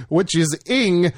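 Ing said slowly and clearly; sounding it out. Shown as a demonstration. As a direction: stretch out Ing as its own syllable. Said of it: "and I N G".

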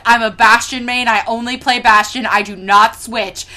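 loud, emphatic gamer declaration; stated loudly as plain fact; mock matter-of-fact. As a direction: imitate someone laying down the rules. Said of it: "so matter-of-factly".

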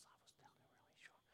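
a near-silent breathy whisper; hushed whisper of tongues, almost no voice. Shown as a demonstration. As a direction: barely breathe the sounds out. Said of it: "speak in tongues like this".

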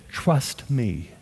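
lightly voiced calm command, hushed; quiet voice of God. Preacher voicing God answering his prayer, softly hushed.

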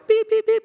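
very high, loud beeps; imitated censor bleep. Pastor mimicking the bleeped cussing behind the group, high-pitched bleeps.

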